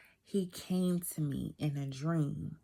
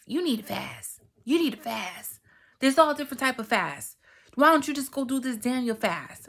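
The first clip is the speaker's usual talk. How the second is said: imitating a pushy friend, higher pitch; insistent urging; 6 semitones higher, 5 dB louder than usual. Best friend telling her to fast, insistent. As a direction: press the advice harder as it goes, getting louder. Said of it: "And she telling me".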